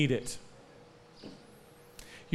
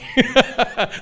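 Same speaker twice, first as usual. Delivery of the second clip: laugh; 6 semitones higher, 6 dB louder than usual. Real, not acted. Laughs at own joke.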